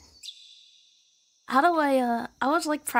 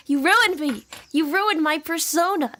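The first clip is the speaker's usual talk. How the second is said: mock accusation; 4 semitones higher, 5 dB louder than usual. Mock blaming chat.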